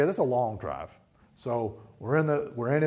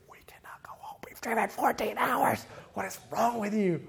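hushed then higher frustration; exasperated inner thought; 11 semitones higher than usual. Retold or imitated. A dad silently fuming at his daughter. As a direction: mutter it to yourself, then burst. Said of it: "And I'm thinking".

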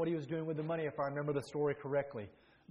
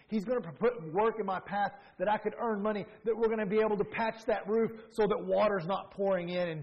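louder, higher preacher's cry; full-force preaching; 6 semitones higher, 7 dB louder than usual. Acted. Preacher rallying the congregation, in a loud, higher preaching cry. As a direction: preach it out, voice lifted high.

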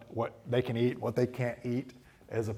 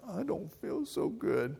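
put-on high, slow, queasy child; sick kid voice; 7 semitones higher, 3 dB quieter, slower than usual. A dad imitating his queasy child. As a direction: drag it out like a little kid about to be sick.

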